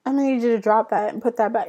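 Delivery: loud, high barked "Hey, you"; brother's bossy order. Retold or imitated. Brother ordering her to drop it, loud and bossy. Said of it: "how he says it".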